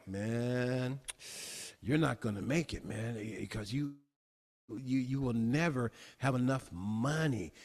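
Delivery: low, muttered voice of self-doubt; inner doubting voice. Acted. Preacher voicing a discouraging inner voice, low and muttered. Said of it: "if my feeling tone is like".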